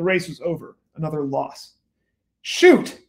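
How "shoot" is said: sudden sharp yelled curse, much higher; mock yell. Gambler yelling at his losing horse, loud burst. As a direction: shout it as the race is lost. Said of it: "Chinaski yelled again".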